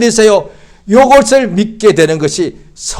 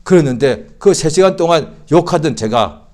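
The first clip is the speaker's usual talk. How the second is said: normal talking voice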